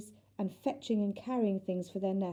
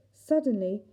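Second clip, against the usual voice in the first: ordinary delivery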